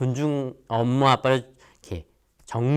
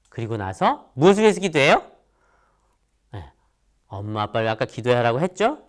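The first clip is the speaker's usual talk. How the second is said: ordinary delivery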